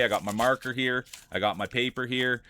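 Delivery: normal talking voice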